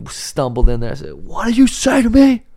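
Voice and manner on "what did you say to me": high, loud mock outrage; hammed-up disbelief at a toddler's sass; mock outraged dad. Dad hamming up shock at his daughter's reply.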